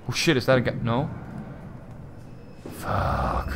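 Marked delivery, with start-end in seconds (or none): 2.8-3.5 breathy whispered swear; whispered curse. Real, not acted. Creepy moment, whispered.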